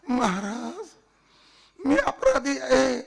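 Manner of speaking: wailed, drawn-out confession; tearful pleading. Acted. Pinned official confessing to the Maharaj, pleading tearfully. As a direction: beg for mercy in a mock crying voice.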